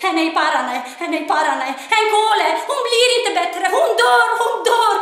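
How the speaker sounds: desperate mother wailing, half-sung at the end; mother screaming. Retold or imitated. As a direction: scream in rising panic. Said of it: "Och mamma skrek".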